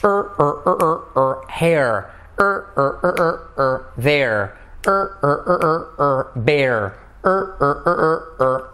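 repeated "er" sounds rising then settling; vowel drill. Shown as a demonstration. Teacher drilling the "er" vowel sound. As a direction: chant "er, er" before each word. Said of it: "Fíjate, repite conmigo".

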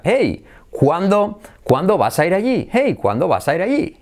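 "cuándo vas a ir allí" high and swooping; English intonation. Shown as a demonstration. Teacher shows English tune in Spanish, high swoops. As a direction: start high on HEY, bounce through CUÁNDO and ALLÍ. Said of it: "en español sería".

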